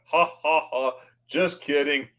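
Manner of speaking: imitated stiff, spaced-out ha-ha-ha; lightly mock the serious lady's joke; deadpan joking inspector. Ticket lady teases her regular passenger, mock serious. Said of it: "she's all serious".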